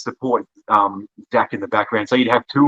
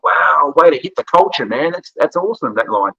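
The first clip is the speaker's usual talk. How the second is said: higher-pitched, admiring quote of a rival; impressed reaction; 6 semitones higher than usual. Retold or imitated. Wrestler retelling a rival's praise for an insult, impressed and higher-pitched. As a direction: imitate someone wowed by a great line. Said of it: "and then Zachy's like".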